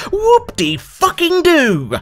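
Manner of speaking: high, slow mock cheer; sarcastic whoop. Real, not acted. Ranting at the devs, a slow sarcastic cheer. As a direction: fake celebration dripping with scorn. Sarcastic jab at waiting years.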